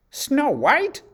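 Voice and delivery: high, loud, furious shriek of the name; queen's outraged shriek; angry shriek. A narrator's reading. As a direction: shriek it in jealous rage. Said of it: "shrieked the angry queen".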